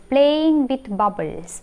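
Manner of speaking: "playing with bubbles" stretched out very slowly, each word held; slow, drawn-out repeat. Shown as a demonstration. Teacher repeating a memory hook to students, very slow and deliberate. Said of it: "bubbles ആയി നമുക്ക് connect ചെയ്യുമ്പോൾ കിട്ടും".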